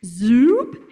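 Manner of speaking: loud, swooping "zoop" with rising pitch; imitated zoop. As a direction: zip upward like a sudden switch. Imitates brain's attention shifting.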